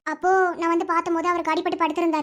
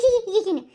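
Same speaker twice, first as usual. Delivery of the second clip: mocking giggle; 2 semitones higher than usual. Jealous wife mimicking his giggling on the phone, mocking. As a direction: imitate a toothy giggle with scorn. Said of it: "பல்ல இழிச்சு இழிச்சு பேசுற".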